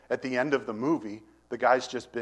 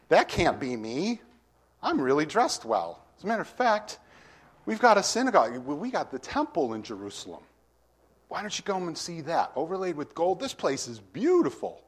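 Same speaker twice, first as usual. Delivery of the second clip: proud boasting; 5 semitones higher than usual. Acted. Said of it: "let's see what these people are thinking".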